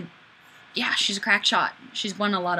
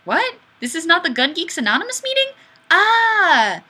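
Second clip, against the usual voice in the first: mock surprise; 8 semitones higher, 5 dB louder than usual. Acting confused in comment.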